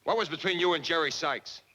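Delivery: loud, high, accusing question; heated jealous interrogation; jealous demand. Acted. Jealous quarrel, demands an answer.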